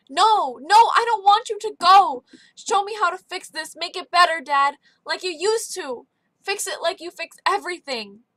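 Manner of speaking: loud, high, begging through tears; a son crying out to his fading father; desperate pleading. Acted. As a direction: plead with him not to go. Boy begging dying father stay.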